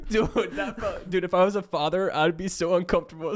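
laughing high while talking. Real, not acted. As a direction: talk through a giggle. Laughing after the dad skit.

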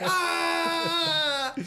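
high shriek of shock; hammed-up shriek; mock scream. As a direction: let out a startled scream. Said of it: "He's like".

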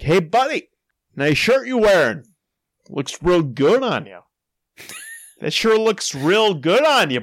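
high, teasing holler to a pal; mock yelling. Man teasing someone he takes for his friend, mock yelling. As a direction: call over with big swoops in pitch. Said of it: "I thought I was yelling to my friend".

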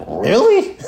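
laughing, disbelieving shout; surprised laugh. Retold or imitated. Called by the casting director, surprised and laughing. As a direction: burst out in happy disbelief. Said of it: "And I was like".

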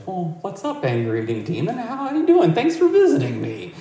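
high, bright welcoming greeting; the king kindly welcoming the demon; friendly king. Retold or imitated.

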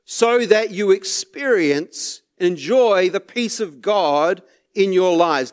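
usual speaking voice

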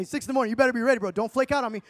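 ordinary talk, nothing marked

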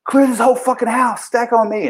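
high-pitched re-enacted order to the team; jacked-up command. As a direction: imitate calling out orders, all amped up. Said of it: "I was all fucking jacked up".

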